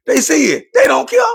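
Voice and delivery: sharp jump up in pitch; high outraged. Real, not acted. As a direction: cry it out high in disbelief. Outraged peak of rant.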